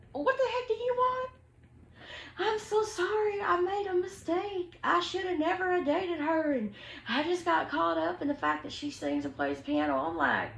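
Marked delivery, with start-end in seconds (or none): 0.1-1.3 much higher, put-on indignant voice; annoyed snap. Answering the cheating boyfriend's call. 2.4-10.0 a hint of a groveling ex begging forgiveness; mock pleading boyfriend. Boyfriend's apology voiced in retelling.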